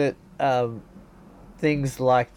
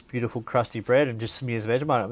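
normal talking voice

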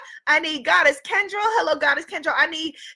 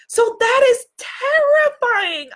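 emphatic; 8 semitones higher, 4 dB louder, slower than usual. Real, not acted. Mock horror at client's fears.

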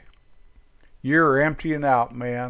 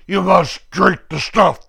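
bullying interrogator; 5 semitones higher, 7 dB louder than usual. Retold or imitated. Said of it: "the bad guys going".

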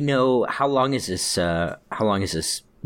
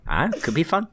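light, higher imitation of Phil; imitating Phil; 8 semitones higher than usual. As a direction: echo Phil's tossed-off verdict. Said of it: "I say it the same way Phil does".

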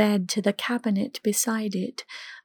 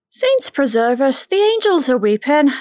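hammed-up Irish brogue, higher, louder and fast; thick mock Irish accent; 5 semitones higher, 6 dB louder than usual. A narrator's reading. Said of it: "in a thick, put-on accent that was much heavier than her usual Irish lilt".

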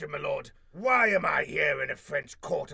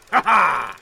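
loud triumphant ha ha; gloating cackle; villain laugh; 11 dB louder than usual. Acted.

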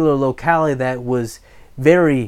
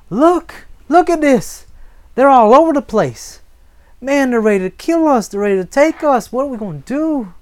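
high, loud, frantic alarm; panicked servant; 10 semitones higher, 5 dB louder than usual. Acted. Servant alerting his master to the surrounding army, panicked. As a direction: cry out in rising panic at being surrounded. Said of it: "And his servant said to him".

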